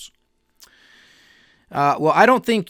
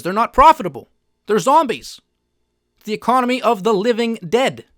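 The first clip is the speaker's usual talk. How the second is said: louder, higher, punching each phrase; raised voice, indignant; loud rant; 5 semitones higher, 5 dB louder than usual. Real, not acted. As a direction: hammer home the zombie image. Ranting about zombie companies.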